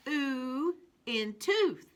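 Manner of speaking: Ooh in tooth said slowly, ooh stretched long; slow phonics drill. Shown as a demonstration. Teacher modelling ooh in tooth for children. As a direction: hold the ooh so it sings. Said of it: "let's look at these two again".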